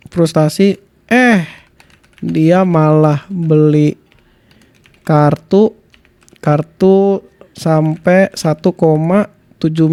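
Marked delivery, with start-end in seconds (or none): 2.2-9.9 slow, low, halting dictation. Real, not acted. Streamer feeding ChatGPT a prompt about Windah, slow dictation. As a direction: spell out the prompt slowly, with gaps.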